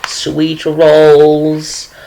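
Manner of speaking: put-on high sweet old lady voice, gushing over her baking; a chatty granny showing off recipes; sweet old woman. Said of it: "she is like".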